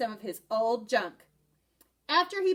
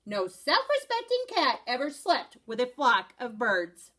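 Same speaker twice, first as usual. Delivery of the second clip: usual speaking voice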